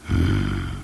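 low, closed-mouth hum; baffled hmm. A narrator's reading. Paszczak understanding nothing of the letter, low baffled hum.

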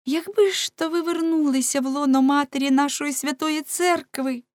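high, fervent woman's plea; побожна жіночка. Acted. (високий благальний жіночий голос)

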